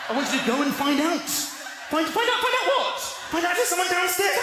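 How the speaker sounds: wife yelling at her husband to go and check downstairs, frantic; exasperated shout. Acted. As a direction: shout it through the laughter at the husband. Acted marital argument shouting.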